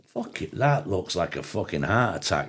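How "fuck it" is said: sudden high exclamation, soft; startled high burst; shocked outburst. Real, not acted. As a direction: blurt it out in shock. Reacting to food on screen.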